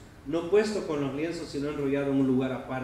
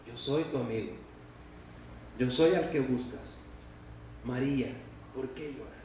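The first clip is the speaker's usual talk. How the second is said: softer, lower, intimate; tender friend; 3 semitones lower, 5 dB quieter than usual. Acted. Jesus gently tells Mary he is her friend, low and tender. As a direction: speak as a close friend calling her. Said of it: "lo que le está diciendo Jesús es".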